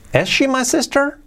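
brisk, joined-up question; fluent second take of a question. Shown as a demonstration. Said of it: "un poquito más rápido que lo repite".

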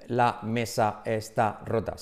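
slow, every word separated in "La mesa está rota"; halting, one-word-at-a-time "La mesa está rota". Shown as a demonstration. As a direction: say "La mesa está rota" as spaced-out single words. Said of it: "no hablan así, no dicen".

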